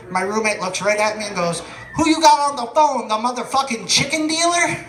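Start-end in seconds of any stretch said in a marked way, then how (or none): 1.9-4.8 mock shout. Roommate's punchline retold.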